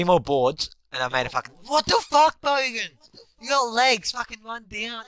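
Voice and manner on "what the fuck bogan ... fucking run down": high, loud exasperated shout; angry yell. Real, not acted. Gamer yelling at teammate Bogan, high angry shout. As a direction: bark it at the teammate.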